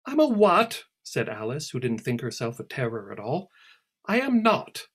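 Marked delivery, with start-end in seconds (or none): 0.1-0.9 high, loud startled question; indignant surprise. Acted. Alice reacts to being called a termaid, startled. 4.1-4.9 high, loud offended retort; indignant denial. Acted. Alice rejects Celia's insult, indignant. Alice denies being terror.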